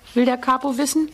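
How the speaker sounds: usual speaking voice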